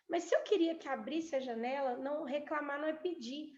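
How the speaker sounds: normal talking voice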